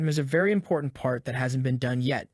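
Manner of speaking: ordinary delivery, nothing marked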